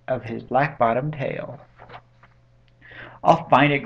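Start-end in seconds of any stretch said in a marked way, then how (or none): 3.2-3.8 loud, determined declaration; storybook resolve, raised voice; crying out. A narrator's reading. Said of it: "cried Horton".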